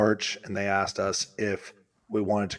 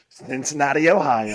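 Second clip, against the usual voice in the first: loud, higher mock announcement; big-reveal speech; 5 semitones higher, 7 dB louder than usual. Co-host imitating husband's gift reveal, grandly. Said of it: "drum roll, please".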